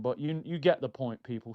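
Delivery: ordinary talk, nothing marked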